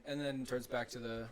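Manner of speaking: ordinary talk, nothing marked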